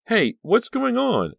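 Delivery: put-on a small boy calling out from the doorway; little brother demands to know what is happening; shrill demand. A narrator's reading. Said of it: "a shrill voice demanded".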